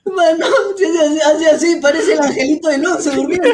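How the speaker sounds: high, wheezing laughter through the words; helpless laughter. Real, not acted. Joking with friends about a photo, laughing helplessly. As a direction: barely get the words out for laughing.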